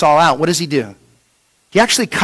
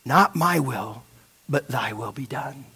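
much softer and lower, fading to nearly nothing; hushed prayer; 8 semitones lower, 12 dB quieter than usual. Acted. Jesus surrendering to the Father, hushed and fading.

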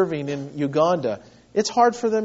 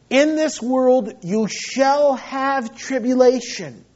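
slow, raised, weighty scripture reading; slow solemn quote; 6 semitones higher, 4 dB louder, slower than usual. Acted. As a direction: give each word its weight, slowly and a little higher. Quoting Jesus's words to disciples.

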